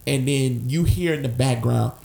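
ordinary talk, nothing marked

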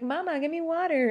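high, lightly voiced little child asking mama; a hint of a whiny toddler begging. Mom playing her future child asking for water. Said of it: "they're gonna be like".

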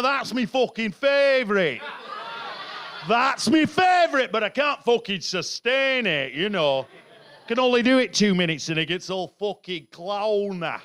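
put-on northern English voice; northern English accent. Comedian showing off his favourite, northern English accent. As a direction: slip into broad northern English. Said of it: "'cause I like that one there".

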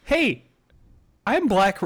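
a needy voice begging to be noticed; mock Ben Carson. Comic mocking Carson's ad, high pleading voice. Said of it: "he's like".

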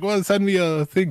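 dad's voice pushed up high; imitating dad. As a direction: chirp it like an eager dad. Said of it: "he's like".

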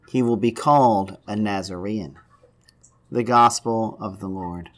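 solemn, measured Gospel reading; scripture reading. Acted. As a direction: read the scripture to the congregation. Reads the Gospel aloud.

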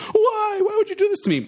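very high, melodramatic wail; hammed-up wounded squeal; mock yelling. Teen keeps up prank on girlfriend, mock yelling. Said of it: "I just start yelling".

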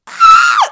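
very high loud shriek. Retold or imitated. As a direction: scream in disgust. Moth on her lip.

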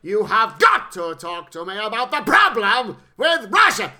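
slow, loud, hammy Russian general; shouty crackers acting. Mocking the actor's overblown line with a slow shout. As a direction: bellow it like a ham actor pushing each word. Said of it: "shouty crackers acting".

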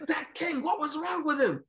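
high, hammed-up grumbling rage; mock fury. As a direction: play the fuming commentator for laughs. Mimics Pacheco fuming off air.

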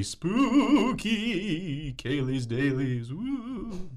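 slow, hushed ghostly tease; spooky ghost voice, drawn out; haunted voice. Pretend. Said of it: "anytime you get to use the haunted voice".

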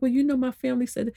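high, quick mimicry of a complaining caller; imitated excuse-making. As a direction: rattle off the same old excuse, mimicking her.

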